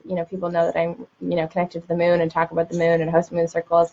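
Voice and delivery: normal talking voice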